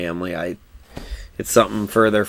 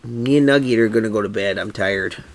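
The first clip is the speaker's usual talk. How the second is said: normal talking voice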